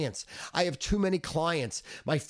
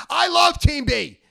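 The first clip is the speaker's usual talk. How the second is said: sudden high loud shout; loud boast; 11 semitones higher, 11 dB louder than usual. Real, not acted. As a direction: belt out the pick. Yells his contrarian pick.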